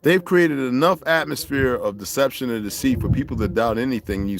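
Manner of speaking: ordinary talk, nothing marked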